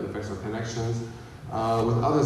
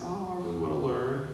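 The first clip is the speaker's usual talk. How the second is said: mock curious; 5 semitones higher, 6 dB quieter than usual. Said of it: "he's not just being curious".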